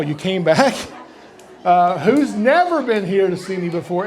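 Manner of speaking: usual speaking voice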